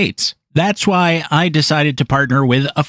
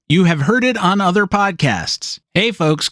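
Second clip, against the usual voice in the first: lightly phony live-read greeting; phony ad pitchman; 4 semitones higher than usual. Shown as a demonstration. Podcaster mocks the dreaded live read, phony pitchman. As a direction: do a mock podcast ad opener. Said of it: "it's not supposed to be phony".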